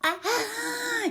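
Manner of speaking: sharp high gasped "ah" as the throat tightens; panicked gasp. Shown as a demonstration. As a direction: catch your breath in sudden panic. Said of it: "You can start going".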